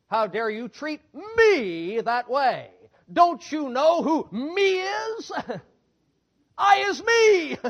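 slow, loud, indignant whine of a proud man, breaking into laughs; mock wounded pride. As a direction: ham up the offended ego, drawn out and outraged. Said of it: "Anger is the offspring of pride".

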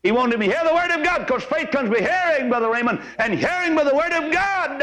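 high, sustained preacher's cry; ringing high-pitched sermon delivery; impassioned preaching. Real, not acted. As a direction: preach it out with rising fervor.

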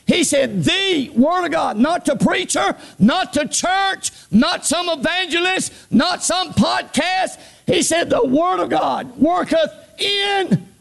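high, stretched-out sermon cry; fervent preacher at full pitch; full-force preaching. Real, not acted. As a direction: build the list to a drawn-out climax.

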